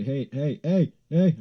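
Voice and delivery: re-enacted calls, rising in pitch and volume; calling out. Calling to the passerby who dropped cash, building call. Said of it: "I say".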